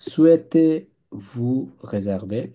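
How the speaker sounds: very slow Souhaitez-vous réserver, stretched; slow model. Shown as a demonstration. Teacher explaining the phrase to students. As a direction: draw out each syllable. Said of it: "wish বা ইচ্ছা করা".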